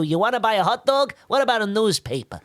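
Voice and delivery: fast New York street vendor; New York accent. Acted. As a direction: hawk it quickly, New Yorker style. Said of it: "That sort of voice is the easiest New Yorker".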